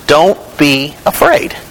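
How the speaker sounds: "Don't be afraid" spaced out word by word; slowly, pausing between "Don't", "be" and "afraid"; slow, deliberate emphasis. Acted. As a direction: let each word of "Don't be afraid" stand alone. Repeats the scripture line for emphasis.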